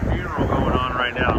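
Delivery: ordinary delivery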